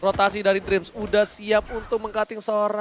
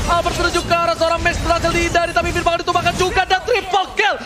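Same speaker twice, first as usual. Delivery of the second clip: fighting game announcer; 8 semitones higher than usual. Acted. Hyped play-by-play during teamfight.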